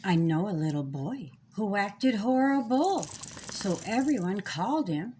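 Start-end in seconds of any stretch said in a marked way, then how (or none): none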